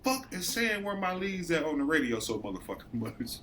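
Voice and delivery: annoyed boss griping to Jake; irritated complaint. Retold or imitated. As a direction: complain about the radio calls to a coworker. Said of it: "I said".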